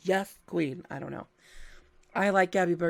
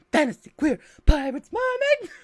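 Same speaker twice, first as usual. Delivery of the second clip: excited pitch; 8 semitones higher, 7 dB louder than usual. Shown as a demonstration. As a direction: sell it like the best combo ever.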